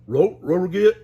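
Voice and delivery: loud slow dog-speak; loud drawn-out Scooby voice. Acted. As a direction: bark out the milkshake order. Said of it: "added Scooby".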